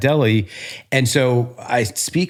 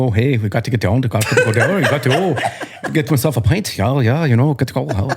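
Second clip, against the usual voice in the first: hammed-up, muddled Irish-Scottish brogue, half laughing; horrible Irish-Scottish accent; 2 semitones higher than usual. Shown as a demonstration. Guest obliging host with homeland talk, bad Irish-Scottish accent. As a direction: badly fake an Irish-Scottish pub-goer. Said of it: "let me hear your Irish Scottish".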